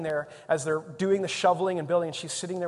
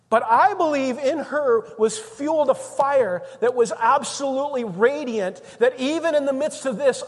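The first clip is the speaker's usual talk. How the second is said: loud, raised preaching voice; impassioned preaching; 5 semitones higher, 7 dB louder than usual. Real, not acted. Preacher honouring a woman who suffered, impassioned preaching. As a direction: drive each phrase home with conviction.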